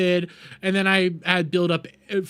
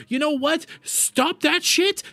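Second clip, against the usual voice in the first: much higher, exasperated demand; real angry rant; 11 semitones higher, slower than usual. Real, not acted. Streamer ranting at opponents, angry demand. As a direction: order them to stop, pitch jumping up in anger.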